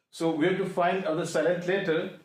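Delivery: normal talking voice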